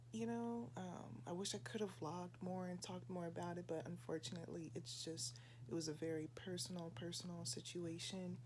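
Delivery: soft, hushed, keeping voice down. Real, not acted. Talking hushed in another room.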